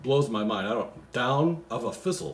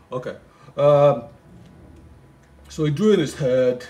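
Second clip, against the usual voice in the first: reads the Christmas poem aloud, a bit louder; poem reading; 3 semitones higher, 6 dB louder than usual. Acted. Comic reader reciting the Christmas poem, lightly sing-song. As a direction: recite the verse with a touch of storybook lilt.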